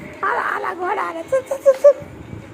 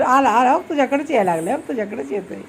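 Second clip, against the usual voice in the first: ordinary delivery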